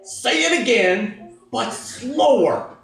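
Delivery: high, drawn-out parental order; slow, exasperated mock demand. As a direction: spell it out slowly.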